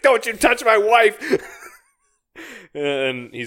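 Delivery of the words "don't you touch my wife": furious dad yell. Retold or imitated. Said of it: "He's like".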